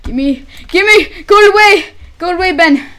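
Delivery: high, loud yell to go away; shouting. Real, not acted. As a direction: shout the villager off your diamonds. Yelling at villager over diamonds.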